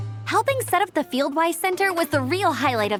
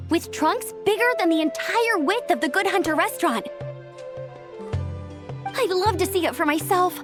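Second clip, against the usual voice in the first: higher-pitched, eager gushing; excited; 5 semitones higher than usual. Acted. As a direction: bubble over about the adventure ahead. Traveler eagerly imagines exploring forest.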